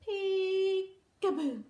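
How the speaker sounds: long drawn-out peekaboo; slow playful reveal. Shown as a demonstration. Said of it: "Cover your eyes".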